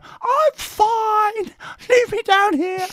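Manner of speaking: put-on high, sulky drunk whine; mock pouty drunk voice. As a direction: whine it like a sulking drunk on the floor. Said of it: "pouty face going".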